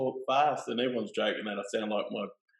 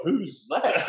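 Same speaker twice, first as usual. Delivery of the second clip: mum's puzzled question; 8 semitones higher, 5 dB louder than usual. Retold or imitated. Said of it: "Mum's looking at me like".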